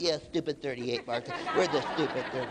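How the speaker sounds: lightly voiced freshmen parroting the seniors; a hint of grumbling freshman attitude; copycat freshmen. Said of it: "You know what the freshmen do?".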